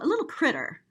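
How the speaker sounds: usual speaking voice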